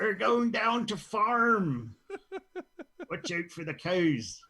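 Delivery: slow, high, hammed-up West Country yokel accent; terrible Cornish farmer accent. Host mocking Cornwall locals in a deliberately bad Cornish accent. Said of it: "doing a terrible accent like that".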